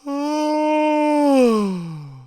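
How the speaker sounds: long, loud, high yawn gliding down; demonstrated fake yawn. Shown as a demonstration. Streamer proving you can yawn manually, big yawn. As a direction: yawn on purpose, big and drawn out. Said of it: "I could be like".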